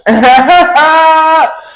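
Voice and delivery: loud, high cackle; high laugh. Real, not acted. Vlogger laughing at her own burn to viewers, loud cackle. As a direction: let a high laugh burst out. Laugh after "you're trash.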